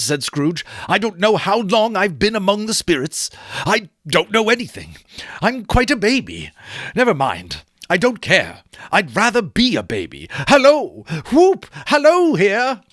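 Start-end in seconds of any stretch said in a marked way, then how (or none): 0.9-10.3 put-on higher, childlike glee; old man declaring himself a baby; giddy Scrooge. Scrooge voiced in story reading. 10.5-12.8 much higher, loud whooping hello; joyful whoop. Acted. Scrooge whooping in joy.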